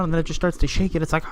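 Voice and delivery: usual speaking voice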